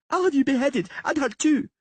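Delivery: high, loud royal threat; girl threatening both of them; imperious threat. A narrator's reading. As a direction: order his head off, spoiled. Princess threatening.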